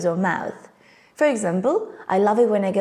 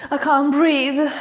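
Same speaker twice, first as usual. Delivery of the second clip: high, slow gasping for air; acted panic; 4 semitones higher, slower than usual. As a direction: gasp it out as if suffocating. Acted panic, unable to breathe.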